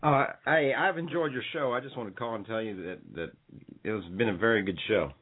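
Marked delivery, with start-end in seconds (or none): none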